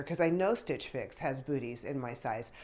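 ordinary talk

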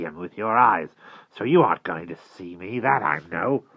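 put-on wary old man, loud and insistent; suspicious old vagrant. A narrator's reading. Walker's suspicious character voice.

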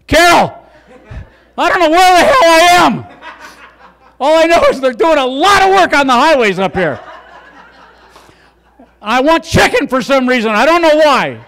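high, loud, frantic little fly voice; fly calling home. Pretend. Comic voicing a lost fly shouting down the phone to Carol, high and loud. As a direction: squeak it out like a lost fly phoning his wife. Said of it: "he's calling home".

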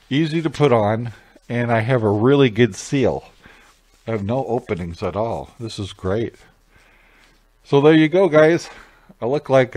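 muffled behind cloth mask; speaking under breathing mask; through a face mask. Real, not acted.

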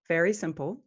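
ordinary delivery

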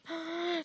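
sharp, high gasp; quick high intake of breath; startled gasp. Real, not acted. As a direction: gasp in alarm at a sudden threat. Spiders suddenly appear.

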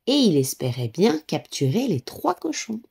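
ordinary delivery, nothing marked

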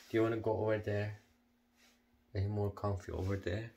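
usual speaking voice

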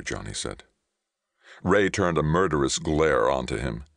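plain reading voice

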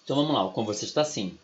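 ordinary talk, nothing marked

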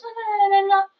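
high monotone gibberish nagging; mocking babble. Girlfriend imitating boyfriend talking on and on, mocking drone. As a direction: mimic endless talk on one high note. Mimicking his endless pleading talk.

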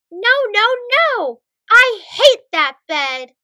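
put-on stomp and yell like a kid refusing bed; tantrum shout. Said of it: "He pouts and shouts and stomps".

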